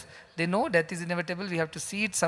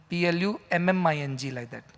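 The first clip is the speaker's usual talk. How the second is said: ordinary talk, nothing marked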